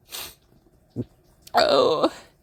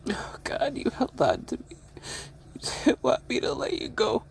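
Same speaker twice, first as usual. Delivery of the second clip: softer, whispered through tears; tearful whisper; 7 semitones higher, 6 dB quieter than usual. Real, not acted. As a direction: whisper it, overwhelmed. Whispered grief at cat's death.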